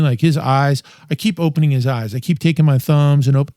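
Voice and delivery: usual speaking voice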